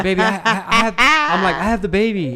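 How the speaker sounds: high, loud flustered pleading through laughter; laughing excuse. Retold or imitated. Dad begs his daughter off the game, laughing and flustered. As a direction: beg off with a nervous laugh.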